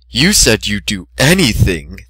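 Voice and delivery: slow sultry princess; slow teasing alicorn voice; Princess Luna voice. Acted. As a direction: toy with the captive slowly.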